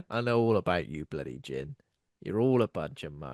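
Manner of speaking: gruff bouncer. Acted. Doorman Johnny Valentine dismissing gatecrashers, low and contemptuous. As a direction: sneer at the would-be partygoers.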